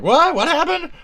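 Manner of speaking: very high, startled squeal; hammed-up high-pitched panic; retold alarm. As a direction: imitate yourself jolted by sudden news. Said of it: "I was like".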